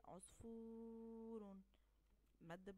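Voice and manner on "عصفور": عصفور very slow, the long و held; slow demo of عصفور; drawn-out model word. Shown as a demonstration. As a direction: say عصفور slowly so they hear the long vowel. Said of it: "أنا نطقت ايه؟".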